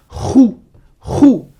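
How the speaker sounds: "khou" with rasped kh before a rounded ou; syllable demo. Shown as a demonstration. Teacher gives the third syllable, "khou" with guttural onset. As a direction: scrape the throat into "khou". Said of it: "ça te donne la syllabe".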